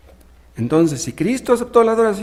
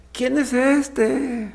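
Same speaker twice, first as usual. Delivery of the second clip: awed question; 7 semitones higher, much slower than usual. Acted. Disciples' awed question, acted.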